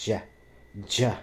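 "ja" with an English j sound; correct pronunciation. Shown as a demonstration. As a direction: demonstrate "ja" starting as in "jam". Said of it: "it's really not that hard".

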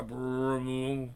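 rumble noise. Acted.

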